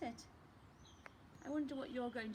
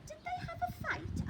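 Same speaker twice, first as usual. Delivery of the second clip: put-on much higher, eager little-boy voice; excited child; 4 dB louder than usual. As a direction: squeak the question like an excited kid. Child Lester asks eagerly.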